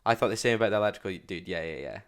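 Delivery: usual speaking voice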